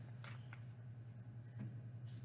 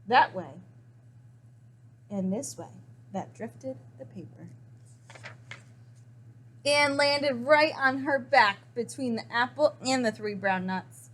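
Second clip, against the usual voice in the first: usual speaking voice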